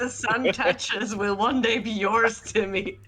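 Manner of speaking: lightly voiced royal father to his child; Lion King impression. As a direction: grandly promise a kingdom, half in jest. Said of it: "these guys are going Lion King on me".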